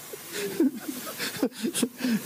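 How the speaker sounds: preacher's own amused laugh. Real, not acted. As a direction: laugh at your own joke.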